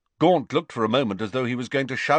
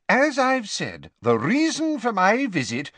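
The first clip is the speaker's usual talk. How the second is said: higher, insistent official; lightly voiced official; 11 semitones higher than usual. Official restarting his business with the father, formal. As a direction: press on formally with raised pitch.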